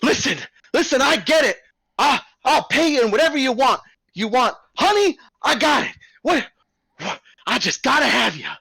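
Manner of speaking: high, loud, eager showman host; a fast-talking circus boss buttering up the bears; the ringleader, high and loud, coaxing a new act. Acted. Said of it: "He's like".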